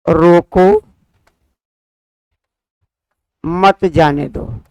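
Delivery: "रोको" alone, long pause, then slow "मत जाने दो"; pause after रोको. Shown as a demonstration. Teacher dictating the second example sentence, pause after रोको. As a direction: stop after रोको, join मत to जाने दो, slowly. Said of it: "यहाँ ठहराव दिया गया है".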